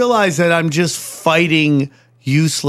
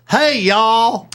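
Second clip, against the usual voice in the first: mock Southern drawl, pitched up; really Southern; 7 semitones higher than usual. Teasing co-host about family phone calls, high mock Southern accent. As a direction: tease with a hammed-up Southern greeting. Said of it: "do you get really Southern?".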